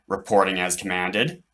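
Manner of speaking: ordinary delivery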